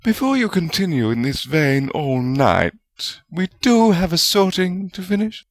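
understated, mildly dry. A narrator's reading. Woman cutting short two bickering colleagues, dry. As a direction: dryly call them to order. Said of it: "Rowena spoke up dryly".

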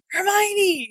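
calling out. Retold or imitated. Ron calling out in mind.

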